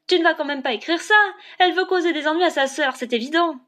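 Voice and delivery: high, loud indignant protest; angry outburst. A narrator's reading. Classmate accusing Elinor of troubling her sister, furious outburst. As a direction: burst out in outraged accusation. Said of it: "Solog bondit sur ses pieds et éclata".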